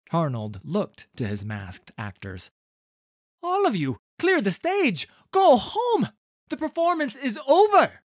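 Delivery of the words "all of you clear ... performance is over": loud, high urgent orders; commanding shout. A narrator's reading. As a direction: shout them off the stage. Tarnold ordering actors off.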